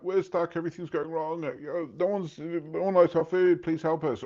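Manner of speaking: high, whiny plea for help from the couple; mock desperate owners begging Gordon; pleading restaurant owners. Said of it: "couple that have called him in".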